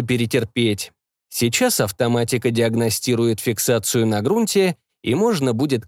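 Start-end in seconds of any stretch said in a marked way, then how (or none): none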